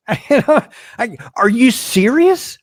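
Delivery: high, slow laughing "are you serious?"; amused disbelief. Real, not acted. To the viewers, scoffing at the reporter's question in disbelief. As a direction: laugh it off, openly stunned.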